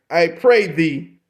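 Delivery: ordinary talk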